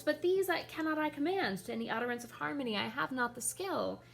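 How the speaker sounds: high, quick, flustered courtier voice; Guildenstern voice. Acted. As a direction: protest nervously, rushing the words. Guildenstern's lines read.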